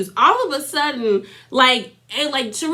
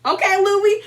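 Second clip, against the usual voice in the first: put-on much higher, teasing singsong like a child; like a little kid; 8 semitones higher than usual. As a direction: tease him like a cheeky little kid. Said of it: "I kinda like the little kid".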